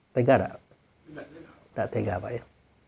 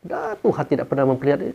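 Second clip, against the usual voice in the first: usual speaking voice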